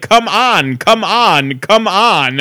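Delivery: each "come on" pronounced as two separate, careful words; mock-enunciate every "come on" stiffly; over-enunciated imitation. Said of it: "And he enunciated".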